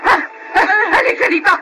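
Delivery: screaming, rising high and crying; furious tearful shout. Acted. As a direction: yell at the girl to come here. Grandmother screaming in quarrel.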